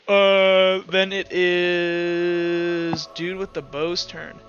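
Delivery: normal talking voice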